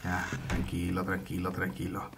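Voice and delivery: low, soft repeated reassurance; soothing calm-down. Real, not acted. Calming down after the scare.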